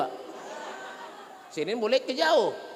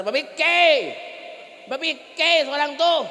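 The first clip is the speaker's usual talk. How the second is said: mocking scold; 5 semitones higher, 3 dB louder, slower than usual. Mocking the old sinner.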